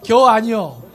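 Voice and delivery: 충청도 사투리로 툭; Chungcheong dialect. Retold or imitated. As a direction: drop it punchily in Chungcheong dialect. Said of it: "충청도 버전은".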